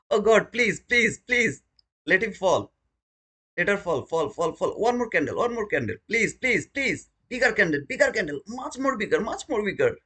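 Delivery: high, pleading mock prayer; mock begging prayer. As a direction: beg heaven for a bigger candle, hamming it up.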